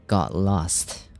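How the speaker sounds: ordinary delivery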